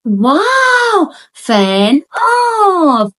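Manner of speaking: put-on high, stretched wow for a toddler; delighted sing-song to a child; excited labeling for baby. Shown as a demonstration. Mother to her toddler, high stretched excited wow. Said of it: "मैं उसको भी verbally label करती हूँ".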